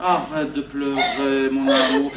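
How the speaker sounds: slow, soft, low comforting; soothing. Acted. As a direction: hush your crying sweetheart tenderly. Console la femme en pleurs. (consolation douce, basse et lente)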